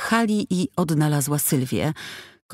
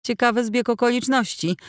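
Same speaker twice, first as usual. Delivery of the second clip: mocking; 8 semitones higher than usual. A narrator's reading. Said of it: "zakpiła Stefańska".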